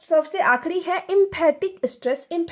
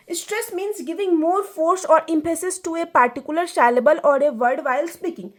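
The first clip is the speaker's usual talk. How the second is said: slow, deliberate reading of notes; dictating slowly to students; slow lecture reading; 3 semitones higher, 3 dB louder, much slower than usual. Acted. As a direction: read the definition out slowly for the class.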